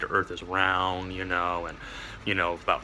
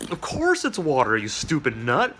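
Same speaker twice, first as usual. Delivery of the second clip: higher, louder scoffing reply; scornful retort; 4 semitones higher, 5 dB louder than usual. Acted. Ordinary fish mocking the philosopher fish, scornful. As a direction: brush him off like an idiot. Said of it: "the other fish is going like".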